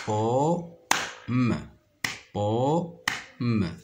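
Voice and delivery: "Pomme" as po... mme, pause between; clapped-out syllables. Shown as a demonstration. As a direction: say "Pomme" in two beats, humming the m alone. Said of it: "Je frappe les syllabes".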